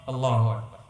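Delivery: ordinary delivery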